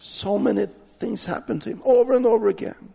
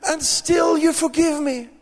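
very high, amazed, tearful voice; overjoyed friend; 14 semitones higher than usual. Retold or imitated. Forgiven friend thanking the preacher, very high and tearful. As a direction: squeal his disbelief at being forgiven. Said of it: "he said".

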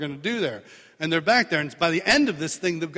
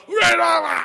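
crowd shouting back loud and high; crowd roar; 12 semitones higher, 8 dB louder than usual. Retold or imitated. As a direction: roar it like thousands. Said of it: "they'd all go".

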